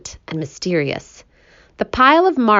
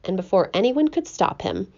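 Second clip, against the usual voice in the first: usual speaking voice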